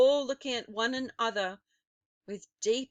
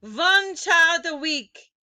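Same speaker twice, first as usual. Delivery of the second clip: put-on loud, higher accented witch; crying out; 6 semitones higher, 11 dB louder than usual. A narrator's reading. Grand High Witch scolding the audience, loud. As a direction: snap it out impatiently. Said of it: "the Grand High Witch cried".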